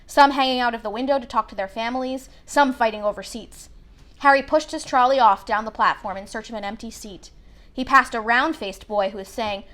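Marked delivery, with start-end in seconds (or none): none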